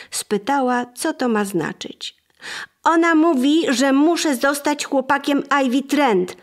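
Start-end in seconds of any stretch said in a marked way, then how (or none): 2.8-6.4 put-on louder, higher sulky boy's complaint; sulky grumble. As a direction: grumble it out like a cross boy. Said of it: "burknął Gerald".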